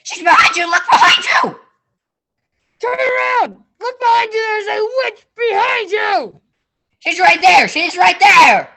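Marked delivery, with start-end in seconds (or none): 2.8-6.4 frantic yelled warning; panto crowd callback. Acted. Audience yells warning at Hansel. 7.0-8.7 panto crowd callback. Acted. Audience yells warning again.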